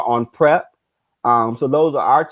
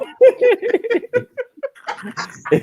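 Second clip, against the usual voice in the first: laughing. Real, not acted. Laughing at coworker's nervous laugh.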